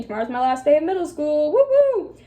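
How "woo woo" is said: high squeaky cheer; excited. Retold or imitated. Mock cheer in diary.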